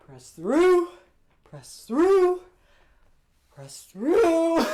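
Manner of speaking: rising high, loud self-encouragement; pumping himself up. Real, not acted. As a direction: psych yourself up. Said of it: "Don't give up".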